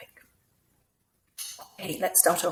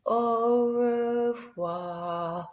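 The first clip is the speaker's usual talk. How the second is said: extremely slow, stretched-out syllables; different speed; 4 semitones higher, much slower than usual. Shown as a demonstration. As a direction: draw every sound right out, slightly raised pitch. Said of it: "saying them at different speeds".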